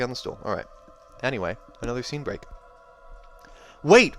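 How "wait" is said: sharp high shout of alarm; cried out. A narrator's reading. Wraith calling out to Cal, loud shout. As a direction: call out to stop her. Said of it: "Wraith cried".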